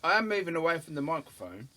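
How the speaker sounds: chewing while talking; mouth full. Real, not acted. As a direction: talk round a bite of food. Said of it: "hear you chomping on your sausage".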